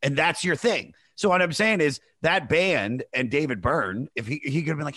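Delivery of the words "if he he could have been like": normal talking voice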